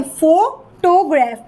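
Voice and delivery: "Photograph" slowly, first syllable "फो" stressed. Shown as a demonstration. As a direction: lean on the opening "फो" of "photograph". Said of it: "हो पे sound पे ज़्यादा stress है". ("Photograph" में "फो" पर ज़ोर, धीरे)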